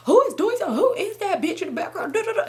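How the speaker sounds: high, indignant imitation of a rant; mock going off. Boyfriend asking about the girl in background, mimicked rant. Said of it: "He just going off".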